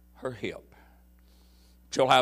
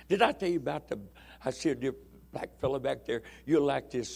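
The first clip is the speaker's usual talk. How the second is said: usual speaking voice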